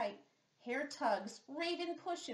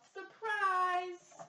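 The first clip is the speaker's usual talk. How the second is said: Surprise called out high and loud; shout of surprise; 6 semitones higher, 6 dB louder than usual. Acted. Friends yell to Bear, shouting in surprise. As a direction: yell Surprise like party guests.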